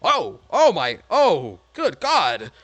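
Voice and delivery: loud, high startled outbursts; horrified, punched exclamations; shocked exclamations. Acted. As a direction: recoil aloud at a shocking sight. Guards react to the revealed creature.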